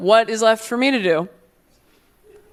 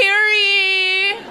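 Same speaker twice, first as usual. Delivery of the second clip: much higher, proclaiming herald cry; mock herald; 11 semitones higher than usual. Influencer's big announcement, mock town crier.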